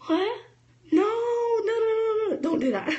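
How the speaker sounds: high, startled no-no-no; mock alarm. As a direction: yelp it like you caught someone mid-mistake. Mock alarm at the wrong approach.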